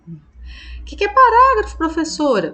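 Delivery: high, eager mock student voice; student asking. Teacher mimics a pupil asking her, high and puzzled.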